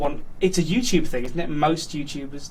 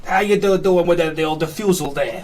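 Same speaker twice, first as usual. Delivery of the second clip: brassy 1940s Brooklyn wiseguy, louder and higher; 1940s Brooklyn accent; 3 semitones higher, 4 dB louder than usual. Acted. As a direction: hit it like an old Brooklyn gangster.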